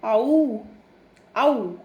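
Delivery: आऊ said loud and slow, moving from आ to ऊ; drawn-out ou sound. Shown as a demonstration. Teacher showing pupils the ou sound, slow and clear. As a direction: glide clearly from आ into ऊ in आऊ. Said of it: "ओ यू की sound होती है आऊ".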